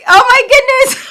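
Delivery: stunned exclamation, voice shooting up; shocked. Retold or imitated. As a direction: blurt it out in pure shock. Shocked at interviewer's remark.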